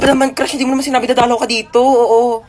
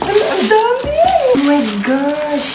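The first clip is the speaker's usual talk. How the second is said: higher, then slow drawn-out gush; delighted exclaim; 4 semitones higher, slower than usual. Acted. Child thrilled by the many milk tea flavors from mama, delighted gush. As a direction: gush over the surprise.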